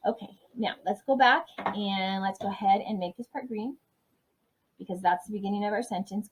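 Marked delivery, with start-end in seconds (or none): none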